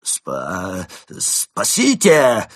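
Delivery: strained, stuttered scream; squeezed-out cry for help. A narrator's reading. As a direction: force out a stammered yell for help. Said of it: "выдавил из себя Крот".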